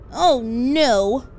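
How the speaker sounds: loud, high "oh no" of alarm; a mother caught off guard; dismayed cry. Acted.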